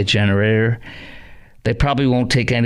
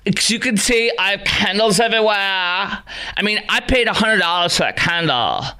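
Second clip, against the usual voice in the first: higher-pitched mock whine; whining woman impression; 5 semitones higher than usual. Host mimicking customer moaning about candle costs, whiny. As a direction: imitate a well-off woman complaining. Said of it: "you want me to do the impression".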